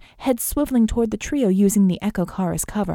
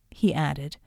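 ordinary delivery, nothing marked

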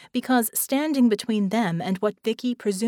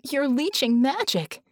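higher-pitched startled exclamation; exclaiming; 6 semitones higher than usual. A narrator's reading. Vicky calls out the leeching magic, exclaiming. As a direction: exclaim it high, mildly excited. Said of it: "Vicky exclaimed".

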